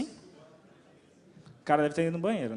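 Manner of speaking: usual speaking voice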